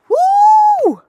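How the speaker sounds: loud, very high "whoo" of attraction; hammed-up high whoop at someone gorgeous; admiring whoop. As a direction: imitate a smitten man's whoop. Jacob first sees Rachel.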